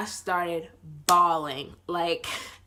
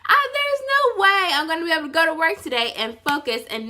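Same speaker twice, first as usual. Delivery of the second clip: high, loud, fast protest out of laughter; laughing disbelief; 7 semitones higher, 5 dB louder, faster than usual. Real, not acted. Vlogger explaining why work was impossible, laughing disbelief. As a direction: burst out incredulous, pitched way up.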